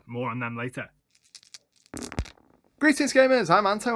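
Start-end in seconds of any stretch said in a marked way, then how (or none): 2.8-4.0 big, high, loud hello; excited greeting. Acted. Youtuber's energetic channel greeting.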